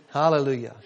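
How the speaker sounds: normal talking voice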